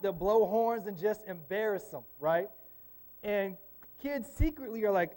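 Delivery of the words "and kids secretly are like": usual speaking voice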